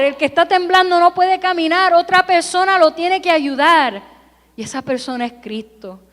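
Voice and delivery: usual speaking voice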